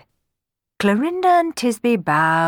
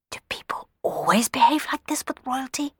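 higher-pitched whispered question; 8 semitones higher than usual. A narrator's reading. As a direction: whisper it aside to a companion. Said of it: "Dandini whispered to Ramiro".

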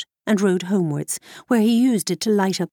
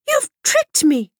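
high, slow outraged cry; angry cry; 4 dB louder than usual. A narrator's reading. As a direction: cry out in betrayal. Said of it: "cried the princess".

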